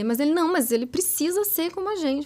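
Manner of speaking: imitated parental objection; parent's protest.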